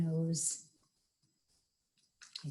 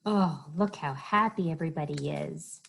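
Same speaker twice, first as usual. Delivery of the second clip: usual speaking voice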